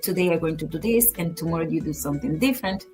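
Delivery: ordinary delivery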